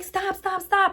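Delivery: high, frantic calls to stop, rising; retold frantic yell. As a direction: flag down the cop in a panic. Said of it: "frantically yelling at him to stop".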